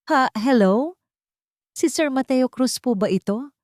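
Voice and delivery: put-on a frightened old maid calling late; trembling with fear. A narrator's reading. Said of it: "nanginginig at puno ng takot".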